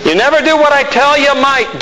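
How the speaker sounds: mock an exasperated God scolding him; nagging God. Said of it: "Do you think God speaks like that?".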